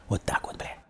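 whispered aside; secretive whispered aside to Kolya. Real, not acted. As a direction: drop to a whisper to close the tale. Hushed wrap-up of the tale.

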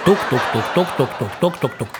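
laughing tuk-tuk pecking noise; pecking imitation. Mimicking a crow pecking dung, laughing tuk-tuk.

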